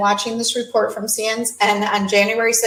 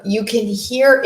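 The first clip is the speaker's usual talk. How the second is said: ordinary delivery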